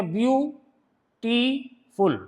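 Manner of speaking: "Beautiful" slowly in three separate parts, view, टी, फुल; teacher sounding out "beautiful" syllable by syllable, very slowly; split into syllables. Shown as a demonstration. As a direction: break "beautiful" into view… टी… फुल, pausing between each. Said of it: "तीन part में बोलेंगी".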